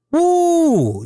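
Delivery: long, high, loud whoo. Real, not acted. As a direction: let out a sustained high-pitched whoo. Excited reaction to wrestler's energy.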